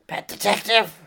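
hoarse, gravel-throated rapper impression. Rapper guest listing his films to the host, hoarse. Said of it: "Sounds an awful lot like [name]".

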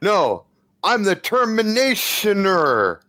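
"Terminationer" high and drawn out; stranger's grand announcement. Acted. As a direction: correct it to a slow, hammed-up "Terminationer". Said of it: "and he says".

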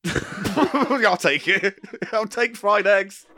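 high, through real laughter; cracking up; laughing. Real, not acted. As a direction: squeeze the words out between laughs. Cracking up at co-host's silly answer.

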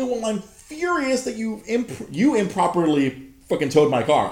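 high, mock-indignant voice; a lightly put-on prissy clerk. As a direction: parody her outrage. Mocks the clerk's complaint.

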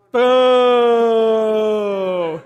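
loud mock boo; leading the audience in a playful boo. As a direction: boo him like a jeering crowd. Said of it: "Boo him".

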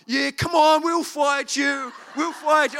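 high, loud battle cry; retold rallying shout. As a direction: shout like kids ready to fight. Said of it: "and they're like".

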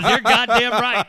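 loud hearty agreement. Retold or imitated. Canadian coworker loving the joke, loud hearty agreement. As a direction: boom it out, imitating a delighted listener. Retelling escalates the delighted agreement.

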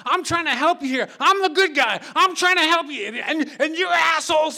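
high, loud enraged rant of an exasperated police officer; screaming cop. Retold or imitated. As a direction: imitate a cop screaming himself into a frenzy. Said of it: "starts screaming all this psycho stuff".

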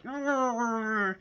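soft wordless growl; low grumbling growl. Acted. Host imitates the look on Henry's face, quiet growl. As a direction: grumble softly under your breath.

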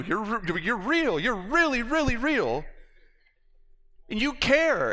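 overwhelmed joyful cry, high and loud; ecstatic cry. Retold or imitated. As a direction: shout it out like you just found out it's true. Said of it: "I'm freaking out and I'm going".

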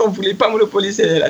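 ordinary talk, nothing marked

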